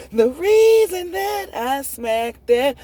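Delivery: high, loud, slow emphatic rant; heated outburst. Real, not acted. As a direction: spit it out in rising fury.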